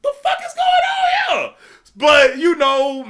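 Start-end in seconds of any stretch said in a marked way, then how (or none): 0.0-1.5 very high, loud disbelieving shout; shocked disbelief. Retold or imitated. Kid baffled by the coach daring him to fight, high shocked shout. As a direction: shriek it in total bewilderment. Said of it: "I'm like".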